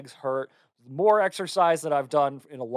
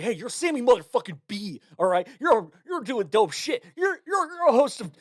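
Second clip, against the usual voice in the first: higher, hyped-up pep talk, like a personal trainer; pep talk coach; 8 semitones higher than usual. Retold or imitated. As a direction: pump yourself up like a coach cheering. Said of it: "you sound like a personal trainer".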